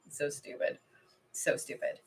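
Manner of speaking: hushed, annoyed whisper under her breath; whispered grumble. Real, not acted. Whispering to herself about the cold weather, annoyed. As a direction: mutter it to yourself, barely voiced.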